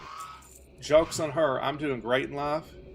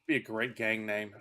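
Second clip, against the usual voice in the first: ordinary talk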